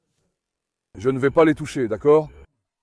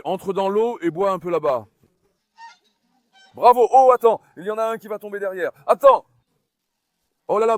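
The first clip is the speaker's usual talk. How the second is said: normal talking voice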